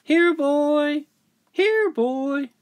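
put-on very high child's call; high sing-out to a pet; calling the dog.

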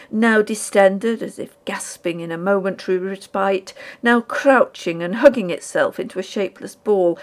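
ordinary delivery

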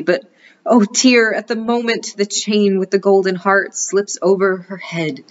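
dramatic narration. A narrator's reading. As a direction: gasp it out dramatically.

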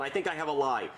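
usual speaking voice

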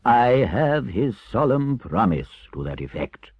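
loud, measured proclamation; solemn, pleased Badger. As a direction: announce it like a pompous elder. Badger announces Toad's repentance.

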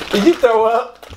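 gagging laugh, spitting it out; spitting out a drink. Real, not acted. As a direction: choke and spit through laughter. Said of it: "I heard it".

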